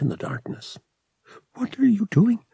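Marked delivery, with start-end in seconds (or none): 1.5-2.4 put-on high, anxious girl's question; nervous young woman, high pitched. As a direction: ask nervously, voice lifted high. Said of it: "she asked nervously".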